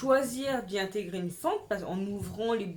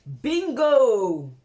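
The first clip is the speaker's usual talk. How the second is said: loud triumphant exclamation; a big delighted shout of bingo; 2 semitones higher, 10 dB louder than usual. Real, not acted. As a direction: announce the reveal like a game-show win. Guessing-game reveal of brand.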